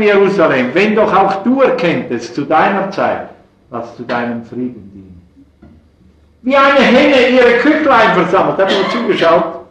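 loud, high impassioned preacher voice; fervent preaching. Acted. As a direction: preach it at full force from the pulpit. Preacher rising to passion.

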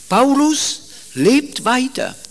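ordinary talk

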